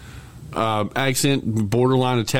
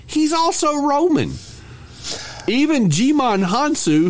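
much higher, drawn-out incredulous rant; exasperated disbelief; slower than usual. Real, not acted. Cohost ranting about British accents in Gladiator, incredulous. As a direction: stretch it out high, as if it's absurd.

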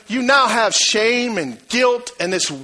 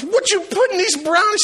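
disgusted son; 11 semitones higher than usual. Retold or imitated. Boy disgusted after eating.

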